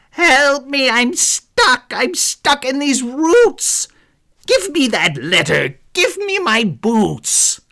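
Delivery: high, loud, panicky pleading; desperate cries for help. A narrator's reading. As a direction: yell like you're trapped in roots. Thief trapped, crying out.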